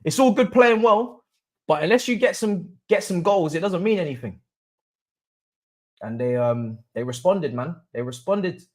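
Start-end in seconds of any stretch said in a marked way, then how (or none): 0.0-4.4 imitating a higher scolding rant at the players; retold telling-off. Fan retelling how he cussed at his team, mock scolding. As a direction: replay the rant, pitched higher. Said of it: "I was just cussing 'cause I was like".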